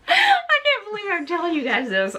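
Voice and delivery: high, laugh-strained confession; real giggles of disbelief at yourself; embarrassed laughing. Real, not acted. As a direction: barely get it out for laughing.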